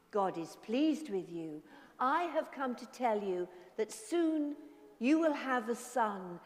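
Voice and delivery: soft storytelling voice; story narration. A narrator's reading. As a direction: tell it gently to children.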